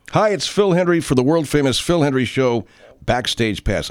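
upbeat announcer read; promo read. Acted.

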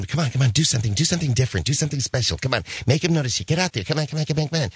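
mock pushy mother, urging; lightly voiced nagging stage mom. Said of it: "the mom who's telling him".

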